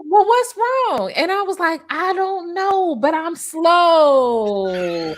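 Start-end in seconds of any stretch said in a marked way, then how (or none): 0.1-1.1 sister's voice. Retold or imitated. Sister voiced, pitched high. 1.9-5.1 drawn-out slurred speech, pitched up; slow slurred high. Retold or imitated. Telling her sister how she feels, slowed and slurred. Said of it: "And I said it like that".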